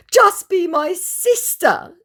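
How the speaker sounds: high, slow, pleading scammer voice; mock begging. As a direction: drag it out like a pathetic plea. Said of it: "Two begging hands".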